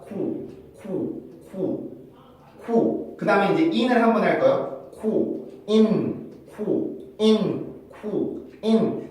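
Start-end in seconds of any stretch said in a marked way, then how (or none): 0.1-3.2 "쿠" said by itself as a short separate syllable; first sound alone. Shown as a demonstration. Teacher drilling the sequence for students, isolated short syllable. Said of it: "그럼 바로 한번 해볼까요". 5.0-9.1 "쿠, 인" as two separate syllables; sounds in sequence. Shown as a demonstration. Teacher shows students the order, syllables split apart. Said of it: "이런 식으로 순서대로 발음을 하는데".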